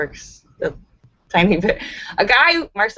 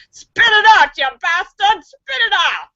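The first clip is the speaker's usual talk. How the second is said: much higher, loud furious yell; 11 semitones higher, 5 dB louder than usual. Acted. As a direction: roar at the fly to cough it up. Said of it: "while yelling".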